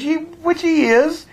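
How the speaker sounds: joking sarcasm before admitting he's kidding; mock sarcasm. Real, not acted. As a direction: tease him with mock-sincere praise. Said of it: "I kid, I jest".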